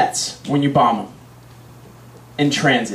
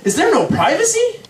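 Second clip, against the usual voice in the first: high-pitched, outraged protest; indignant, soaring question; exasperated thought. Acted. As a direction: cry out in exasperated disbelief. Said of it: "I'm thinking".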